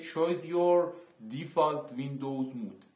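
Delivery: English words read out very slowly, one by one; slow English label reading. Shown as a demonstration. A tutor reads the English setting name to students, very slow and deliberate. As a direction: read the Windows menu label slowly to learners, spelling it out.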